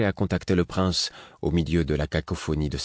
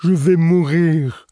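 plainte aiguë et lente d'enfant; gémir comme un gamin buté; whiny tattling little sibling; 11 semitones higher, 8 dB louder, slower than usual. Acted. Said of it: "s'exclama-t-il d'un ton génial". (voix geignarde d'enfant aigu et lent)

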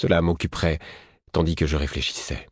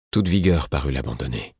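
ordinary delivery, nothing marked

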